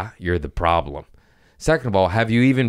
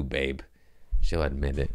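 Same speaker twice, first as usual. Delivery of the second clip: ordinary talk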